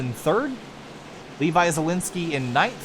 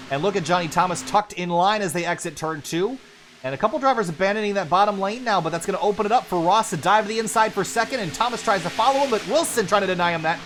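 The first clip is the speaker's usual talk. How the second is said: higher and louder, driving race call; live play-by-play; 6 semitones higher, 4 dB louder, faster than usual. Real, not acted. Commentator calling the pack battle after the restart, excited play-by-play. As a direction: ride the action as cars swap lanes.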